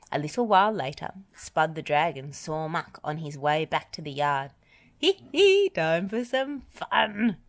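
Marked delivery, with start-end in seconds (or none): 5.0-7.4 high giggle into slow, relishing words; mischievous chuckle. A narrator's reading. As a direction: chuckle over a prank to come. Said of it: "chuckled Spud".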